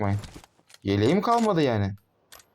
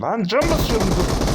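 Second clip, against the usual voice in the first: high, much louder frantic cry; panicked shout; 10 semitones higher, 7 dB louder than usual. Real, not acted. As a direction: yell it in panic while healing. (panikle yüksek sesle bağırarak)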